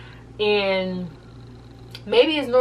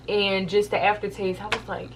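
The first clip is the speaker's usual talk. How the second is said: normal talking voice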